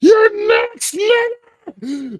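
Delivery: high screeched imitation of the shouting man; mock scream. Mimicking the screaming man from the video, high screech. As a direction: shriek it like the man in the clip.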